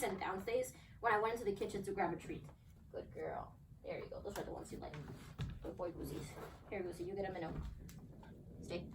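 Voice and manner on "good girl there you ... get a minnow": quiet, lower praise to the dogs; murmured praise with treats; soft praise. Real, not acted. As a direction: hush while rewarding.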